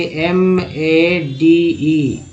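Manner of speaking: letters drawn out slowly; slow spelling. Shown as a demonstration. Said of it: "फिर देखिए". (धीमे स्वर में स्पेलिंग)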